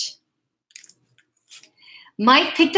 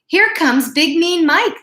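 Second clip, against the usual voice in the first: dogs' voices; 7 semitones higher, 4 dB louder than usual. Acted. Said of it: "the other dogs would say".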